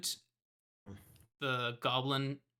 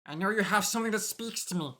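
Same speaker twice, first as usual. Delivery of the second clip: goblin voice; 4 semitones higher, faster than usual. Acted. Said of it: "Bro, I can speak goblin".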